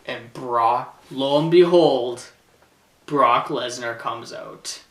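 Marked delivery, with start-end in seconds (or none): none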